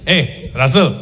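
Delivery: put-on Indian accent, name drawn out further each time; unconvincing Indian coaxing. Indian person prying information out of someone, coaxing in Indian accent. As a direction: coax with an Indian accent, building on one word. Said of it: "trying to convince you to tell them something".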